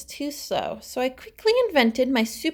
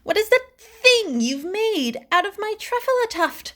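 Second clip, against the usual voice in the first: higher, louder, huffing and puffing; upset shout; 7 semitones higher, 4 dB louder than usual. A narrator's reading. The Lorax shouting at the Once-ler over the tuft. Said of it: "he shouted and puffed".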